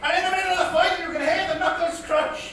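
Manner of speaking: projected, singsong ballad telling; comic poem recitation. Acted. Comedian reciting his dad's old bit as a tribute, declaimed comic verse. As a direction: declaim the rhyme to a crowd.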